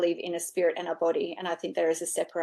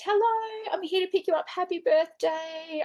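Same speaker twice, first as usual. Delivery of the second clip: much higher sing-song hello, slowing on the last words; cheery greeting; 11 semitones higher than usual. Retold or imitated. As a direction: greet her like a birthday guest, gently. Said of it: "And I said".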